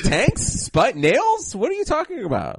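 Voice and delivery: very high, incredulous questions; mock bewilderment. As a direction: act baffled in a squeaky-high voice. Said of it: "He's like".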